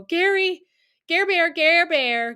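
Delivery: high, urgent mock cry for help; freaked-out calling. Freaked-out patient calling for Gary during eye surgery, mock panic. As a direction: call out the name high, as if panicking. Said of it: "it freaked me out. So I go".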